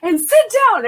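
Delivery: high, loud shouted order; imitating a fed-up boss shouting; retold yelling. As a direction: yell the sergeant's command to put them in their place. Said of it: "whole conversation yelling back".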